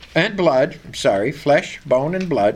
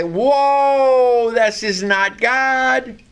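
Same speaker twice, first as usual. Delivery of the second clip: doubting exclamation; 6 semitones higher, much slower than usual. Retold or imitated. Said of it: "A lot of people would say".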